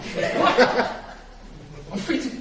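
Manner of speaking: loud, high, laughing "What?"; disbelief. Acted. Freed guilty man stunned at the verdict, loud disbelief. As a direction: gasp it in laughing shock.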